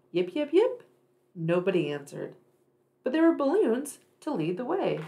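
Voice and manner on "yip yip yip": high yipping fox call. Acted. Little fox climbing down calling, high yips. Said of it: "She called".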